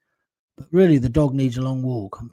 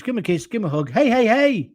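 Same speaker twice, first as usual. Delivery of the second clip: higher, sing-song goodbye; playful farewell; 7 semitones higher than usual. Real, not acted. Streamer telling parents to hug their kids, playful. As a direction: tease a fond see-you.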